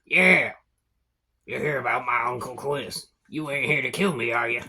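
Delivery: put-on suspicious old goblin at the door; lightly played wary old goblin; lightly voiced old goblin.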